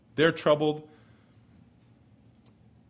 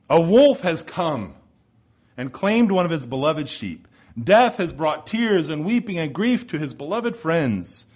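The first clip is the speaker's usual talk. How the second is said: louder, driving sermon voice; rising pulpit fervor on Jesus' anger; fervent preaching; 3 semitones higher, 4 dB louder than usual. Acted. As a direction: preach it hard, land every word. Preaching at full force.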